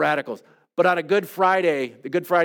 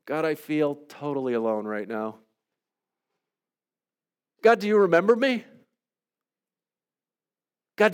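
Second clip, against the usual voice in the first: praying a psalm of lament aloud; heartfelt prayer. Acted. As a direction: pour out a lament before God.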